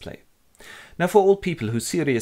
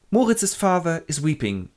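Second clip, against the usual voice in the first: ordinary talk, nothing marked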